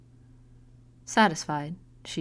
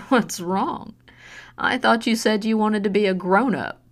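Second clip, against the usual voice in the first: mocking taunt; 4 semitones higher, 6 dB louder than usual. Mother taunts after slap.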